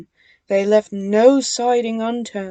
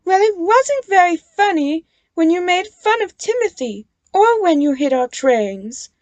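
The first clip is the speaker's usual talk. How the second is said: lightly voiced scolding; a touch of stern Nia; 9 semitones higher, 7 dB louder than usual. A narrator's reading. Nia scolding the twins, lightly stern. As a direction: scold them firmly. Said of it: "said Nia".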